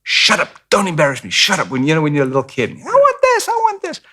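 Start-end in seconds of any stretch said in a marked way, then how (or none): 0.0-1.7 sharp hushed scolding, higher pitch; a mother snapping at her kid in the store; mock mom telling off a child; imitating mom scolding. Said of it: "she'd go". 2.7-4.0 put-on very high childish whine; mock child nagging for a toy; whiny little kid. Kid whining in grocery store.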